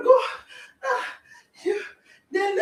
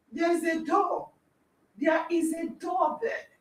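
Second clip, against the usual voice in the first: ordinary delivery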